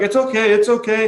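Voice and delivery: calm him down fast and bright; reassuring clerk. Retold or imitated. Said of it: "And she's like".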